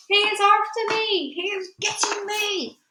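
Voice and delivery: high wail of terror; panicked giant's scream. A narrator's reading. Giant fleeing Jack in his nightmare, screaming. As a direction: cry out as if hunted, pitched high. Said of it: "screamed the flesh lump eater".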